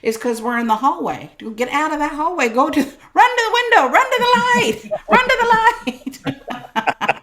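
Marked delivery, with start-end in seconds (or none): 1.4-7.2 high, loud laughing call to run; laughing shout. Real, not acted. Urging listeners out of the hallway, a loud laughing shout. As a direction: cry it out laughing, urging them on.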